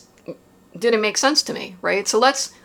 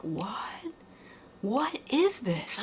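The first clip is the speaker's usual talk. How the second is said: stunned whisper; 4 semitones higher, 11 dB quieter, much slower than usual. Retold or imitated. Shock as the pain came back.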